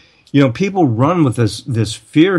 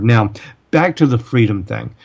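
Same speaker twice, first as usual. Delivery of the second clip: usual speaking voice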